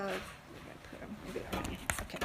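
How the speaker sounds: muttered under the breath while searching; whisper to self. Real, not acted. Speaker searching for the quotes, whispering to herself. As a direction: whisper it to yourself while you hunt for the page.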